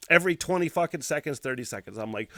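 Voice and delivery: ordinary talk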